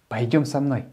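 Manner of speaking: usual speaking voice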